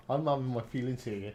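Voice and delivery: ordinary delivery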